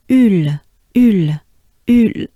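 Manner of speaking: loud, rounded French "ul"; French ul sound. Shown as a demonstration. Teacher drilling the French "ul" sound. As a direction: round the lips hard on "ul". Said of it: "the B that starts it off".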